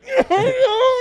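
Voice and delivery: high-pitched, loud strangled laughing wail; strangled laughter. Retold or imitated. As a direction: imitate laughter bursting out while held in. Retold helpless laughter held in.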